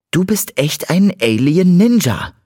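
high, drawn-out exclamation; exclaiming in exasperation. Acted. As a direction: exclaim it as a startled girl, stretching the words. Cat, startled, teases Damon. (hoher, gedehnter Ausruf)

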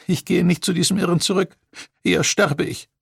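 put-on high, fast, frantic girlish voice; desperate young woman. A narrator's reading. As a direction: rush it in panic. November refuses to go back.

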